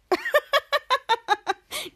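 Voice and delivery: high staccato laugh; laughter. Acted. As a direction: laugh in a long run of pulses. Laughter after Schwoz's prank line.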